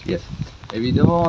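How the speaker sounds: normal talking voice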